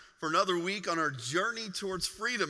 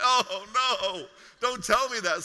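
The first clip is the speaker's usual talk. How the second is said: mock despair; 4 semitones higher, 7 dB louder, slower than usual. Exhausted narrator reacts to warm-up.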